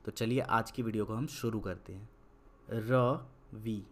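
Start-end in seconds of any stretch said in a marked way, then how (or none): 2.7-3.8 slow dictation. Shown as a demonstration. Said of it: "अब गौर से देखिएगा मैं क्या कर रहा हूँ".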